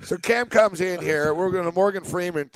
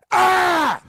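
high, loud startled cry; retold startled yell; 13 semitones higher, 6 dB louder than usual. Host mimicking Cam's yell from the bathroom. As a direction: yelp in sudden surprise. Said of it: "I just hear Cam go".

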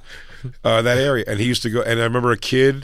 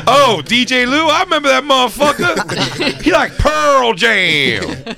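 high, loud, slow sing-song; hammed-up nostalgic guy; mock reply; 13 semitones higher, 4 dB louder, slower than usual. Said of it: "he goes".

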